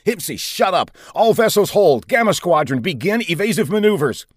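high, forceful commander's voice; shouted orders. A narrator's reading. Commander silencing a captain and ordering evasion, forceful. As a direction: call out orders to every ship.

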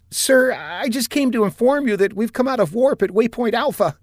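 high-voiced, jittery officer reporting; lieutenant reporting to captain, high and nervous; nervous young lieutenant. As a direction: report while still shaken.

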